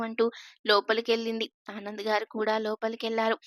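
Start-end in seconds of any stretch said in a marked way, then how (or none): none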